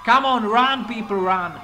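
yelling at the people on the street to run; urgent shout. Real, not acted. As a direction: hurry the crowd away. Urging bystanders to flee.

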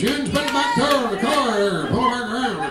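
R-heavy North Georgia twang; thick rhotic North Georgia accent. Acted. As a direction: hit every R like a North Georgia hillbilly. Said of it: "they say yuns".

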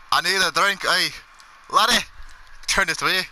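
put-on a thirsty Scotsman demanding a drink, Scottish accent; mock Scottish accent calling for a bloody drink. Playing a drunk Scottish patron.

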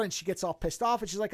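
ordinary delivery

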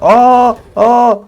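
startled yelp at a sudden kill; shocked gasp. Real, not acted. Gamer startled by enemy, high yelp.

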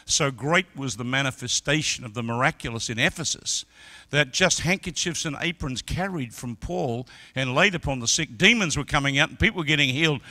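loud, high-pitched sermon climax; full-force preaching. Acted. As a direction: preach it out, building to the roar.

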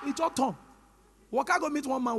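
ordinary delivery

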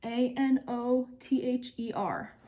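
each letter said slowly and apart; slow, clear letter-by-letter spelling; spelled out letter by letter. Shown as a demonstration. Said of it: "Your board should say another".

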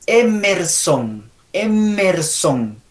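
"Emerson" very slow, M held; slow model "Emerson" in drawn-out syllables; correct pronunciation. Shown as a demonstration. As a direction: stretch EM-mer-son, leaning on the M. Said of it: "vamos a pronunciar estos nombres".

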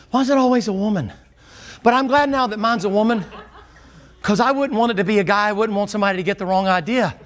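ordinary talk, nothing marked

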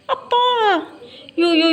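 drawn-out, high, playful fake wail; slow, high mock lament with a laugh in it. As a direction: ham up a pained cry over a bite, half laughing. Pretends the pet's bite hurt.

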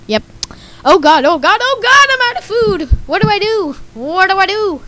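usual speaking voice

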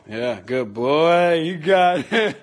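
slow, loud praise for the dog; praising dog. Real, not acted. Praising dog while feeding.

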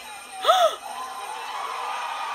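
sharp high breathless gasp; shrill stunned gasp; high awed gasp. Real, not acted. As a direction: gasp as if you can't believe it. Awestruck by routine.

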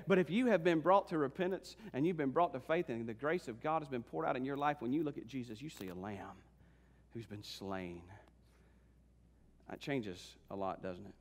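fading to a low, very soft close; hushed preaching. Acted. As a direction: bring the sermon down to reverent quiet. Preaches to congregation.

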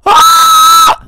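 sudden scream. Real, not acted. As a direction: let out a startled shriek.

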